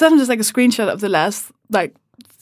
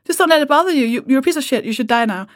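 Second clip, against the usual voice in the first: higher, sarcastic throwing back his words; mock dismissive; 5 semitones higher, faster than usual. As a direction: parrot his advice back with a sneer. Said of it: "and I was like".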